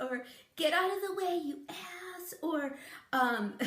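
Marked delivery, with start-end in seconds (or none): 0.6-2.3 mock mad. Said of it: "if I get mad, I'll go".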